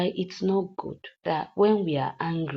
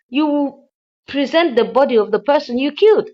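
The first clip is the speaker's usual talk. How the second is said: raised, louder scolding voice, retelling the madam; mock tongue-lashing, higher and louder; imitating angry scolding; 6 semitones higher, 7 dB louder than usual. Madam Uchechi scolding the man she hired, louder and higher in pitch.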